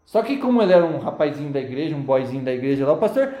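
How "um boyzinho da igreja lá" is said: ordinary talk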